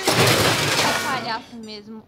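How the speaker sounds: loud, slow, mocking jab; scornful scolding. Coworker loudly mocking her clumsy partner. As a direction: drag it out to mock him.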